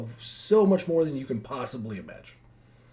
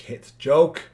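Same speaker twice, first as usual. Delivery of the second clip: teasing; 4 semitones higher, 10 dB louder, slower than usual. Real, not acted.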